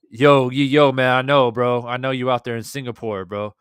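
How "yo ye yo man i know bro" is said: loud, excited call to a far-off viewer; yo, shout it out to the chat. Real, not acted. Hyped streamer calling out to a viewer in Singapore, loud.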